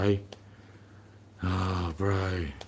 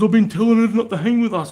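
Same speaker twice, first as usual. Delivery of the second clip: high, louder mock whiny voice of the young guy; 14 semitones higher, 6 dB louder than usual. Dad mocking his daughter's groomer telling bouncers, in a high whiny mock voice. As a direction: make him sound pathetic and defensive. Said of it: "He goes".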